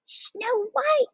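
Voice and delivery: very high, squealed delight; gushing. A narrator's reading. Kitten girls pick their favorite book, gushing. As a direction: gush like excited little girls. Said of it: "gushed the girls".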